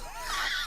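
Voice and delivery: a quick high burst of laughter. Real, not acted. Laughing high after teasing his rich friend. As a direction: laugh up high at your own joke.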